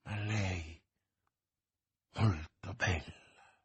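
soft, low, halting words; faint murmur. A narrator's reading. D'Artagnan, near fainting, thinks of the lady, faint murmur. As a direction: let it drift out slowly with long gaps.

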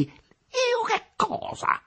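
high, drawn-out indignant challenge; quick retort. A narrator's reading. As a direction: throw it straight back at him. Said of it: "rimbeccò pronto Capolino". (rimbecco pronto e indignato)

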